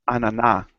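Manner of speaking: ananá, stress on the final NÁ; Argentine word. Shown as a demonstration. Argentine teacher shows her word ananá, stress on NÁ. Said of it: "we say".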